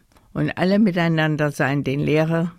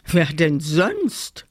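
slow, self-important grumble; grumbled retort; slower than usual. A narrator's reading. Teddy claims the teacher's job, slow grumbled retort. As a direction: draw out the indignant question. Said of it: "brummte der Teddy".

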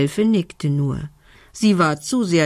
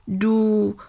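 embarrassed stutter; 4 semitones higher than usual. A narrator's reading. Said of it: "stotterte Lumbi verlegen".

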